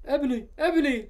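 high, loud, mock-forlorn call; sad shouting. A narrator's reading. Draco calling after Ebony, sad high shout. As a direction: call out to her pleadingly. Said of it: "shouted Draco sadly".

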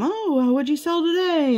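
high, chirpy friendly question; high-pitched friendly clerk voice; friendly postal worker. Retold or imitated. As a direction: play the cheerful clerk chatting. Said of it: "they're always asking him".

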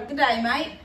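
attempted Aussie accent; Australian accent. Shown as a demonstration. Mother practises slang greeting, attempted Australian accent. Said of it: "can you do it with an accent?".

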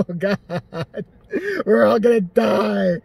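usual speaking voice

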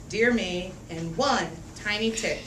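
ordinary delivery

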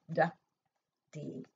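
usual speaking voice

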